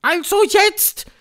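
high, loud, drawn-out command; barking Grand High Witch. A narrator's reading. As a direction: bark it shrilly at the crowd. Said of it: "bellte die Hoch". (bellende Hexe, hoch und gedehnt)

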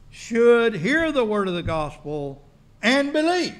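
solemn scripture reading. Acted. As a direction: read the verse like a pronouncement.

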